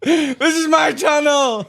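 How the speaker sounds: high, slow bellowed shout; triumphant shout. Acted. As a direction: roar it like a proclamation.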